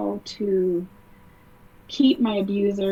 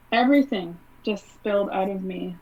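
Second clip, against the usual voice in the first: ordinary talk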